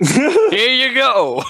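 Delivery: high, loud and drawn out; exuberant. Acted. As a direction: hand it over with a big flourish. Character hands over data pad.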